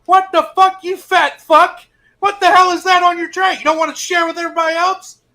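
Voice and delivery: high, loud furious drill instructor yell; mock screaming female TI. Senior master sergeant screams at the recruit over his tray, high and loud.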